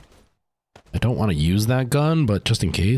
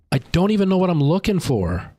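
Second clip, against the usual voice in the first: high, frustrated cry of confusion; exasperated and lost; 7 semitones higher than usual. Real, not acted. Frantic search in horror game.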